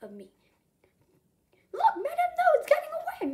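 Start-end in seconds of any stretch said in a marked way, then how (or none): 1.7-3.3 very high, loud cry of alarm; alarmed shout. A narrator's reading. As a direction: call out urgently to the others. Alarm as the thief escapes.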